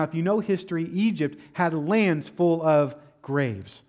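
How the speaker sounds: ordinary talk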